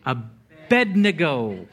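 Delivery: "Abednego" slowly, a-BED-ne-go; correct pronunciation. Shown as a demonstration. Preacher repeating the correction, slow "Abednego" as a-BED-ne-go. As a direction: stretch out "Abednego" as uh-BED-nuh-go. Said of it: "how to pronounce this name".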